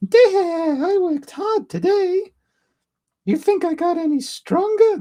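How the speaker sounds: put-on very high little girl falsetto; badly faked child voice; Shuri. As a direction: squeak it out as a little princess. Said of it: "at doing Shuri's voice".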